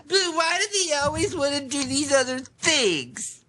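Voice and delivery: high slow nasal whine; whiny voice. Pretend. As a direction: whine like a spoiled kid. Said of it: "nasally, whiny voice".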